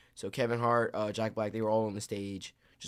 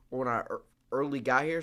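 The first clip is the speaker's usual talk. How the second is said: usual speaking voice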